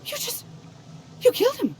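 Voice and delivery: high, stunned, horrified gasp of words; horrified disbelief; shocked and scared. Acted. Witnessing a killing.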